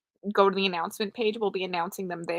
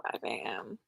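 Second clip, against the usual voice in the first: unvoiced late-night whisper. Real, not acted. Streamer whispering to chat at five AM. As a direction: whisper so as not to wake anyone.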